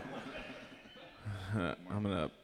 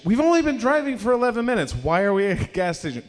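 higher, faster disbelieving rant; mock outrage at a short drive; incredulous; 9 semitones higher, 4 dB louder, faster than usual. Retelling disbelief at gas station.

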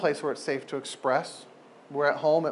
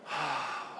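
soft, low sigh of relief; relieved sigh; 3 semitones lower, 12 dB quieter than usual. Acted. Hurting person finally cared for, a soft relieved sigh. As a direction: let it all out with a quiet sigh.

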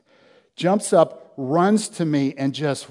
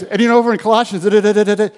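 loud, fast, higher mock eager rattling-off; eager scripture torrent; 9 semitones higher, 13 dB louder, faster than usual. Pastor retelling how the ex-convict quoted scripture at him, loud excited rush. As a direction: imitate him gushing verses nonstop, loud and fast. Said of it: "And he goes".

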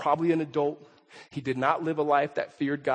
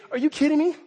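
incredulous scoff; 10 semitones higher, 4 dB louder, faster than usual. Acted. Skeptic scoffs at Christianity.

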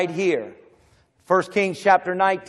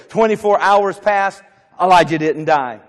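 preaching at full force; 4 semitones higher, 6 dB louder than usual. Acted. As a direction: hammer each line home to the church. Building preached refrain.

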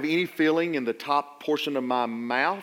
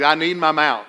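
louder, pressing on "I need my mouth"; emphatic plea; 8 dB louder than usual. Retold or imitated. Preacher begging his surgeon, emphatic and louder. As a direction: lean into "mouth" as if pleading.

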